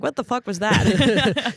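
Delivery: slow, loud mock indignation; mock outrage. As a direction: pretend furious, laughing through it. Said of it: "I'm gonna be like".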